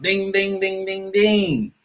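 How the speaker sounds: a shorter, slightly high ding-ding bell; imitated alarm bell. Stopping the play on the clip, bell-like dings.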